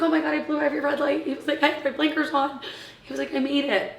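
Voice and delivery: imitating his breathless relief with a laugh; mock frantic rush; excited husband. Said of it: "He's like".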